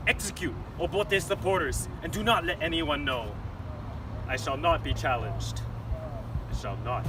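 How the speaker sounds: menacing tyrant monologue; scheming dictator. Acted. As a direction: plot it like a dictator.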